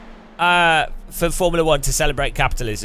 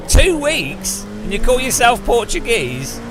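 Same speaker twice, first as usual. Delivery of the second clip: mock outrage; 9 semitones higher, slower than usual. Scolding a Portuguese viewer, high mock disbelief. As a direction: act scandalised. Shocked at no pastel de nata.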